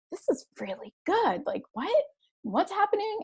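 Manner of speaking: high-pitched, rising disbelief; retold amazement. Parent retelling being hooked by a kids' show, amazed. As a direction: pitch climbs as the surprise lands.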